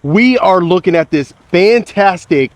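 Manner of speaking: loud, upbeat host welcome; vlog intro. Acted. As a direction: greet the viewers with big energy. Energetic opening of the tour.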